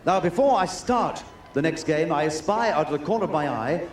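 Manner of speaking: ordinary talk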